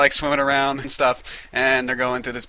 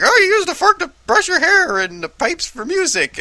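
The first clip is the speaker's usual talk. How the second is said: high silly know-it-all bird; goofy Scuttle voice; 3 dB louder than usual. Retold or imitated. Seagull explains human objects, goofy high voice. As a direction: lecture like a clueless bird. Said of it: "he's all like".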